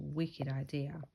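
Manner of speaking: usual speaking voice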